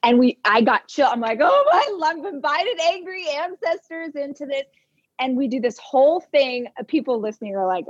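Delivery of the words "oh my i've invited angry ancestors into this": high startled yelp, then hushed; shocked squeal. Retold or imitated. To herself as the dog growls, a startled high squeal. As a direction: gasp it out, suddenly spooked. Frightened realisation voiced.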